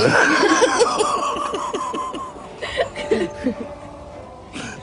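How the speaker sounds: put-on big high-pitched drunken laugh bursting out; loud high laugh. Laughing loudly at his own joke to his companion: a high, loud laugh. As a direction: throw back your head and roar with laughter.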